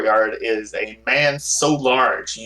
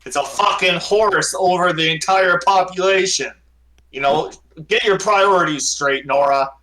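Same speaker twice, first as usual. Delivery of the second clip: angry rant; 6 semitones higher, 4 dB louder than usual. Acted. Baron rants about priorities.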